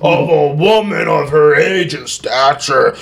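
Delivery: slow, straining theatrical oratory; overblown outrage. Acted. Courtroom baffled by a woman killer, hammed-up outrage. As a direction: build with mock drama to a long drawn-out final word. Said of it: "Don't hurt yourself".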